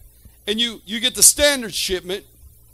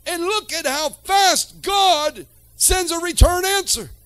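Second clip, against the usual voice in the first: preaching at full force; 5 semitones higher than usual. Real, not acted. Preacher stirs the congregation about weeping, fervent preaching. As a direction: build the sermon to a fervent peak.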